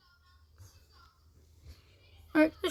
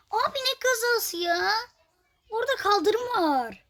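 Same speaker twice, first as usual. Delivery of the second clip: high, outraged protest; high-pitched can you believe this; indignant disbelief; 9 semitones higher than usual. Real, not acted. Protesting the crash penalty.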